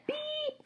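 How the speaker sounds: high flat electronic beep; be the lie detector going off; machine beep. A narrator's reading. Lie detector catching the boy's lie, high flat beep.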